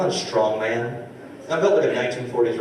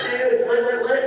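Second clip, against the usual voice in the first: higher, hyped party bro voice; mock hyped bros; 12 semitones higher than usual. Improv guys inviting him back, mock hyped. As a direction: sell the party like a frat bro. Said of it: "They're like".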